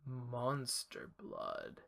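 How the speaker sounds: very slow, low and hushed Monster Blood; ominous label read. Acted. Reading the creepy can's label to a friend, slow ominous hush.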